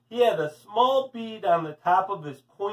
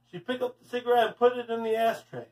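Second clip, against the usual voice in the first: plain reading voice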